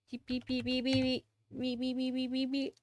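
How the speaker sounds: rapid run of even beeps; beeping noises. Real, not acted. Streamer filling silence, monotone beeping. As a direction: beep steadily on one note.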